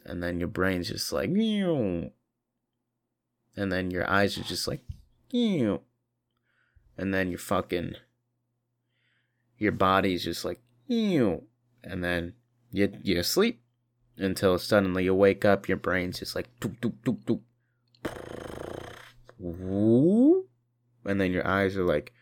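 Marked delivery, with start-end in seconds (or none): 1.3-2.1 high falling mock meow; a silly cat meow; high meow sound. Shutdown sound effect. 5.3-5.8 high falling mock meow; a silly cat meow; high meow sound. Shutdown sound effect. 10.9-11.4 high falling mock meow; a silly cat meow; high meow sound. Shutdown sound effect. 16.6-20.5 beeping boot-up noises; like a computer starting; robotic doop sounds. Pretend. Waking up sound effect.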